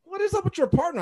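high-pitched exasperated demand; retold worked-up challenge; indignant. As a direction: press the complaint, imitating the coach. Indignant coach repeats his complaint.

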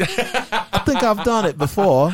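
put-on slow, amused British-style accent; British or Australian accent. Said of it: "a good British or Australian accent".

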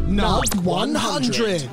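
high, slow, echoing title call; echoing announcer. Acted. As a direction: boom it out like a show segment. Echoed title jingle.

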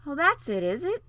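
lightly voiced young princess; a hint of a cartoon princess. A narrator's reading.

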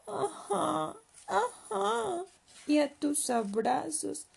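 put-on high, eager child voice; small high voice; little leopard. Cub answers mother happily.

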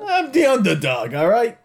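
high, hammed-up hero dog; Underdog. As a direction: push it up into a silly cartoon hero voice. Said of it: "that voice he just made for Underdog".

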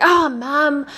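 put-on a kid groaning at Mum's lecture; whiny kid. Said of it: "They're like".

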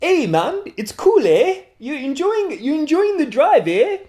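put-on high, goofy Canadian accent; bad Canadian accent. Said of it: "terrible Canadian accent".

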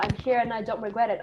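usual speaking voice

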